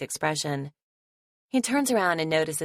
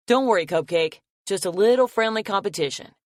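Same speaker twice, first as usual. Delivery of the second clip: calling back; 4 semitones higher, 6 dB louder than usual. Acted. Calling back across the yard.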